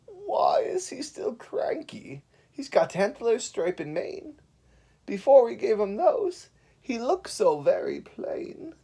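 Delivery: slow, high, breathy whisper from hiding; whispered worry. Acted. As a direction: whisper so the bear can't hear. Animals hiding, whispering about Bear.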